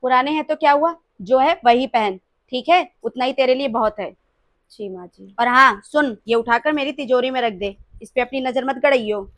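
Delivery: loud harsh scolding of the daughter-in-law. Acted. Mother-in-law orders bahu loudly. (सास का ज़ोरदार डाँटना)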